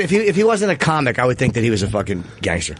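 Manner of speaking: usual speaking voice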